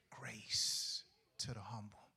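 slow whisper. Real, not acted. Preacher whispering the verse to the congregation. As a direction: breathe the words slowly, barely voiced. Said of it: "whispered scripture point".

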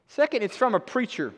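ordinary talk

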